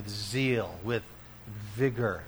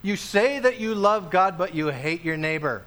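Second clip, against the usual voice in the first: higher and louder rebuke; rebuking quote; 5 semitones higher, 5 dB louder than usual. Acted. Preacher quotes scripture rebuking hypocrites, loud. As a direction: call out the hypocrite. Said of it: "The New Testament talks about it".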